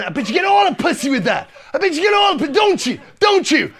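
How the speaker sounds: high, loud, insistent acted pestering; hammed-up high-pitched insistence; excited badgering. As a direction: press eagerly for agreement, voice climbing. Acted passenger badgering, then denial.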